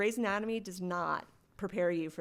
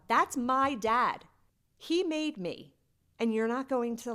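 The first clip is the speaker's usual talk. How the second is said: higher, louder, slow anguished protest; hurt outburst; 6 semitones higher, 7 dB louder, slower than usual. Retold or imitated. Retelling her silent family ignoring her, anguished outburst. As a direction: let the hurt burst out as you retell.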